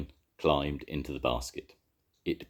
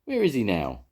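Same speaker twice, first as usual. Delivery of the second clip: higher, urgent question; 6 semitones higher, 4 dB louder than usual. A narrator's reading. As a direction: look round anxiously. Jones searching.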